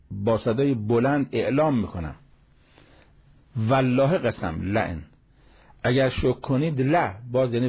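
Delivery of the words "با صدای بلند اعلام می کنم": usual speaking voice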